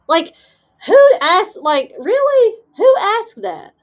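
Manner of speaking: loud, high-pitched disbelief; high, loud incredulous outburst. Real, not acted. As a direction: exclaim it, utterly baffled. Incredulous at an odd childhood question.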